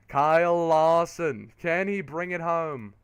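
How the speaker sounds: slow, drawn-out announcer call; race commentary. Real, not acted. Commentator calling the leader late in the race, excited play-by-play. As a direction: stretch the driver's name with excitement.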